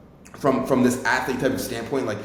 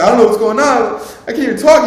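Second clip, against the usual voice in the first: higher and louder, choked-up sobbing words; crying, can't talk; 9 semitones higher, 8 dB louder than usual. Retold or imitated. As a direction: retell it as if breaking down in tears. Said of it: "I went breakdown crying".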